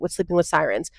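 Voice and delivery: usual speaking voice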